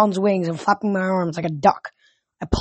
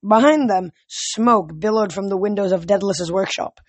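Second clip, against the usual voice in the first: ordinary delivery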